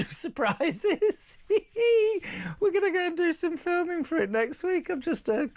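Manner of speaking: giggling through the words, pitch jumping up; can't hold the laugh in while plugging the show; real giggle breaking into the sentence. Real, not acted. Host cracks up mid-plug.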